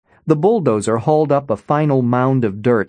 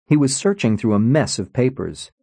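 usual speaking voice

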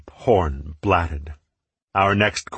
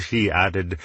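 plain reading voice

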